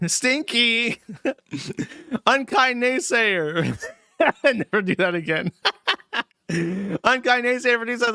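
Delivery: giggling through the words; laughing. Real, not acted. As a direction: squeal it while laughing hard. Laughing fit while reading.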